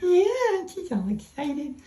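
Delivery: high pet-talk coo. Real, not acted. Speaking to and for the dog.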